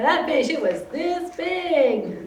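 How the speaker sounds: a fisherman stretching the truth; bragging fisherman. Retold or imitated. Fisherman uncle bragging about catch.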